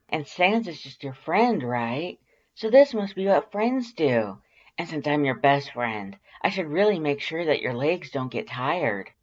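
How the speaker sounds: teasing Undyne. A narrator's reading. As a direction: ham up the taunting as Undyne. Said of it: "she teases".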